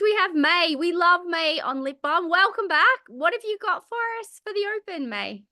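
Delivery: ordinary delivery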